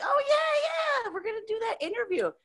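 high, hammed-up enthusiasm; retold eager reply.

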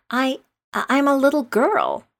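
high-pitched, halting claim; rather doubtful. A narrator's reading. Alice tells the pigeon what she is, doubtfully. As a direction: stammer the start, then offer it uncertainly. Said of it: "rather doubtfully".

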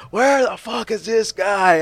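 slow, high mock annoyed call; imitated bandmates on mic. Bandmates call from the stage microphone, mock pissed off. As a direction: drag it out, calling for a missing singer. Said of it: "on the microphone".

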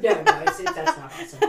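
high laughing protest. Real, not acted. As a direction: laugh it off, voice jumping up. Laughing denial after awkward compliment.

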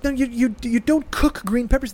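ordinary talk

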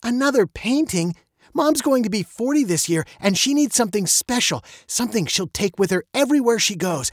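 put-on high-pitched girl, audible eye-roll; exasperated daughter. A narrator's reading. Veronica objects to another painting for Mom, high and exasperated. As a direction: protest to Dad in a high, insistent voice. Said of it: "Veronica rolled her eyes".

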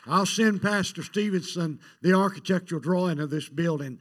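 loud, rising rallying call to the church; preacher's fundraising crescendo, building to a shout; full-force preaching appeal. Acted. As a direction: whip the congregation into giving. Pastor rallying congregation to give.

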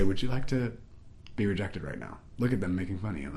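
soft, low, insinuating voice; spirit of rejection. Acted. Spirit of rejection tempting her as people laugh, soft and low. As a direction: tempt quietly, close to the ear. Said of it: "I felt the spirit of rejection say".

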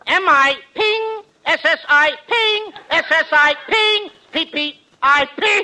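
slow, spelling Mississippi with a "ping" on each I; drawn-out letter spelling, "ping" dotting each I. Shown as a demonstration. Spelling Mississippi for Abbott, slow with a "ping" on each I. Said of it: "what's the ping for".

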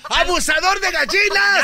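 loud, high accusing yell; shouted accusation. Acted. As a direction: point and yell the charge. Lawyer accusing defendant in court.

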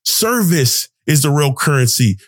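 ordinary talk, nothing marked

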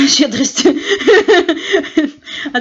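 real laugh bursting out on the last word; breaking into laughter. Real, not acted. Joking to a friend about restaurant generosity, breaking into laughter.

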